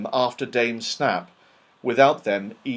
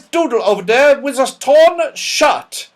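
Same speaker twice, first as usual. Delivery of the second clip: put-on high, loud scolding old dame; Dame Snap, sharp; 13 semitones higher, 10 dB louder than usual. A narrator's reading. Stern old dame scolding a scruffy pupil, loud and high. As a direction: bark it like a cross schoolmistress. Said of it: "said Dame Snap".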